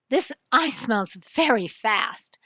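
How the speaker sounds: slow hushed whisper. Acted. Detective whispers to the king, slow hushed whisper. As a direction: breathe it out slowly, secretive.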